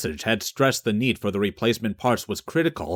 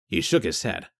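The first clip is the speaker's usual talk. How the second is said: ordinary delivery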